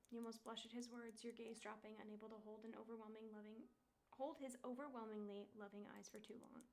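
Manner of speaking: soft, even second-person narration; game story narration. Acted. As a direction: read it like a visual novel narrator. Reads game narration aloud.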